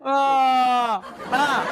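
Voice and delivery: normal talking voice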